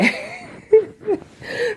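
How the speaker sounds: high, breathy burst of laughter; exasperated laugh. Real, not acted. Viewer laughing at her own anger, high laugh. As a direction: laugh it off after ranting. Laugh after getting mad.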